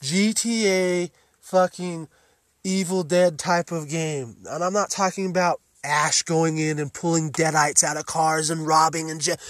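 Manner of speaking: usual speaking voice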